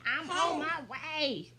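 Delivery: hammed-up dad calling back; imitated urgent dad. Dad answers frantic call.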